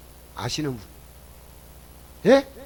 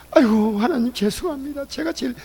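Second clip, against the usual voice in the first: higher-pitched mock contrite plea; apologetic plea; 5 semitones higher than usual. Sinner confessing to God, apologetic plea. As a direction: act out owning up, pleading.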